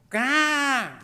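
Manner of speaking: high, loud imitated kid's scream; a mock tantrum yelp; child's tantrum scream. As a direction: shriek like a toddler at the store. Son screaming in Walmart.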